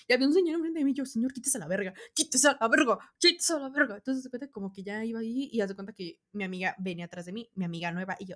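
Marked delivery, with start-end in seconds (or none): 1.0-4.0 impatient yelling. Retold or imitated. Pushing past a man in the crowd.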